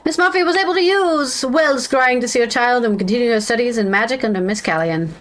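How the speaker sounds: ordinary delivery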